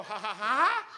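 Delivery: hammed-up burst of laughter, rising loud at the end; imitating a laughing fit; mock laughing. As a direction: play the nervous volunteer cracking up. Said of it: "when you laugh, you go".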